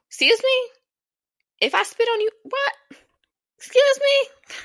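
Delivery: high, loud outraged cry of disbelief. Retold or imitated. Retelling a rude customer, outraged high shrieking. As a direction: shriek it out, offended to the core.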